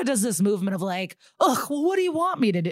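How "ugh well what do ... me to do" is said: fast, high, arms-in-the-air exasperation; mock helpless whine, higher and faster; exasperated whine. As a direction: toss it off like a defensive shrug. Erica throwing arms up.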